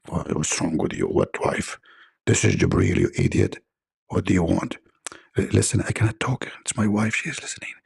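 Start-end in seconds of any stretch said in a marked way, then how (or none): 0.1-4.8 much lower, gruff irritated voice; a cranky angel snapping down the line; gruff angel Jibreel. Acted. Angel Jibreel answering caller, gruff and annoyed. 7.1-7.7 hushed whisper. Acted. Caller hiding from his wife, whispering. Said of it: "why you are whispering".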